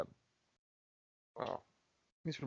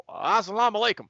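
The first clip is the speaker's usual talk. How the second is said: Southern-twanged "Assalamu alaikum", pitched up; Arabic greeting said as a Southern good ol' boy; Southern-accented salam; 9 semitones higher than usual. Retold or imitated. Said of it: "Arabic with a southern accent".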